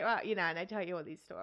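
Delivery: ordinary delivery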